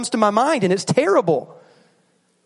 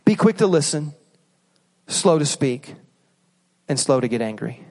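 scripture reading; 5 semitones lower than usual. Shown as a demonstration. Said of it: "Listen to this".

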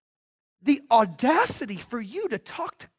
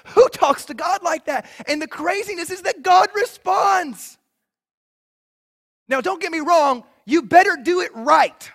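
fervent preaching; 4 semitones higher, 5 dB louder than usual. Real, not acted. Preacher warns the church, loud and fervent. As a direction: preach it at full force, pressing each line.

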